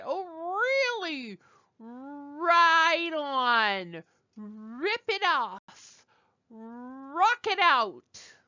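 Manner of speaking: high, drawn-out R in "really", "Right", "Rip", "Rock"; exaggerated English R. Shown as a demonstration. Teacher contrasts our R with French, exaggerated long English R. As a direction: stretch each starting R long at the front of the mouth. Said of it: "R is not like our sound".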